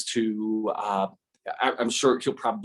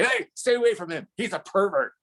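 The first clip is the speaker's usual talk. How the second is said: teasing warning; 9 semitones higher than usual. Retold or imitated. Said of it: "Osvaldo will say to the U P S guy".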